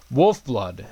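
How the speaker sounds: ordinary talk, nothing marked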